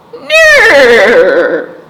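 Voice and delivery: loud falling neigh; whinny like a horse. A narrator's reading. Storyteller neighing as Horse at Duck.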